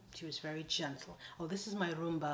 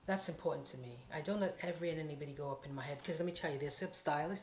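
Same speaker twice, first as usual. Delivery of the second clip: ordinary delivery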